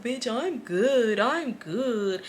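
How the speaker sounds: slow, drawn-out brush-off; mock dismissive friend. Friend waving off the scolding, mock dismissive. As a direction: imitate her lazily insisting, words stretched.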